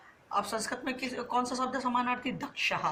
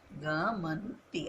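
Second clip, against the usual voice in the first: each syllable of गमंती drawn out very slowly; slow dictation; 5 semitones lower, much slower than usual. Shown as a demonstration. Teacher dictating the wrong word गमंती to students, very slow. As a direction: spell out गमंती slowly so students can write it. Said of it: "अशुद्ध शब्द कौन सा हो गया?".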